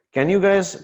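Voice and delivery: ordinary talk, nothing marked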